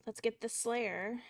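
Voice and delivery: slow, soft and reluctant; downturned tone. Acted. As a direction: let the voice sink, dragging it out quietly. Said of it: "it went very downturned".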